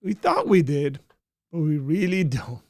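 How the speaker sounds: high, loud mock rejection; hammed-up complaint, starting high and easing down; disgruntled subscriber. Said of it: "Somebody subscribed and they were like".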